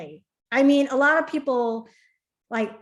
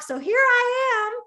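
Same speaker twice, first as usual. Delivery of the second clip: high, slow teasing reveal; playful reveal; 11 semitones higher, 3 dB louder, slower than usual. Retold or imitated. Imitating the friend explaining her visit, high teasing. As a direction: deliver it like a cheeky ta-da. Friend's punchline voiced in story.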